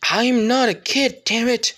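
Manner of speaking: hot-tempered shout; angry outburst. Acted. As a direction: snap back, fed up with being called a kid. Kid angry at being called kid.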